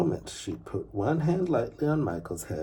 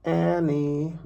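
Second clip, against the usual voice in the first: high, loud call into an empty shop; sing-song call of two names; 8 semitones higher, 7 dB louder, much slower than usual. A narrator's reading. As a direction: call the names out across the room. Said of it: "Mary called out in the empty shop".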